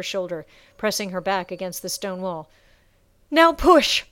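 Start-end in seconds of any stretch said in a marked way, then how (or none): 3.3-4.0 loud, high order; shouted command. A narrator's reading. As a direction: push him on with a yell. Sasha commanding the push.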